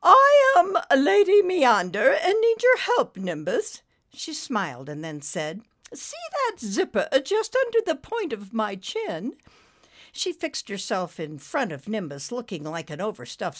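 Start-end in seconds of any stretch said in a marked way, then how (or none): 0.0-3.8 put-on high, loud, stately British accent; theatrical British noblewoman; Lady Meander. A narrator's reading. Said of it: "in a most British stately accent". 5.9-9.4 put-on high-pitched stately British accent; lilting British dame; Lady Meander. A narrator's reading. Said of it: "in a most British stately accent".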